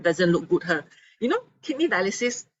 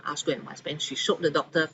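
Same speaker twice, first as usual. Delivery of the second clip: ordinary talk, nothing marked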